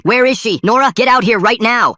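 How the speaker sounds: high, loud furious yelling; screaming. Acted. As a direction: scream it at the top of your voice, hunting for her. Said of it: "starts screaming".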